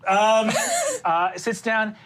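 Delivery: ordinary talk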